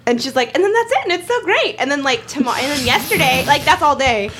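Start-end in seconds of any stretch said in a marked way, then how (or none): 0.6-1.8 high-pitched imitation of a thrilled friend; mock delighted report; gushing friend. Acting out her delighted friend.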